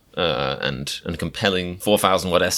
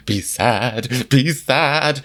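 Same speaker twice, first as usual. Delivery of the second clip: mock-pleading, pitched up toward the end; mock sad music; 5 semitones higher, much slower than usual. Reactor mocking a documentary's pushy music, mock pleading. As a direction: imitate manipulative music, half laughing. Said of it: "the music is like".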